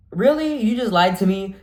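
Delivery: high, loud mock outrage; indignant disbelief. Patient confronts the clinic worker who lied, indignant disbelief.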